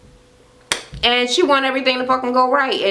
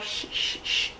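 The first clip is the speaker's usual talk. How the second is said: whispered shh, shh, shh; imitated shushing; 13 dB quieter than usual. Retelling Tanya shushing Nico: whispered shushes. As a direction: shush him like Tanya did. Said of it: "Tanya's like".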